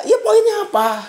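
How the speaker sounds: high-pitched mock sarcastic question. Cell group leader who forced the story asks its point, sarcastic. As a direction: imitate an impatient leader, voice jumping up high. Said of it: "dengan nada nyindir".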